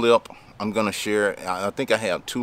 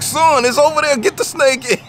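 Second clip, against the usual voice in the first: much higher and louder, urgent and scared; frightened dad; 8 dB louder than usual. Retold or imitated. Scared dad telling his son to get the snake, high and loud. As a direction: push the son toward it, scared. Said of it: "and the dad is like".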